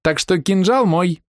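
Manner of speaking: put-on high, slow teasing little girl; cheeky girl voice. A narrator's reading. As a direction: tease him in a bratty child's voice. Дерзкая девчонка дразнит героя. (дерзкий детский голос)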